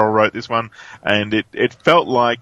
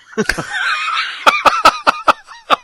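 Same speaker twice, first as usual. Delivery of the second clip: loud laugh; 3 dB louder than usual. Real, not acted. Laughs at own joke.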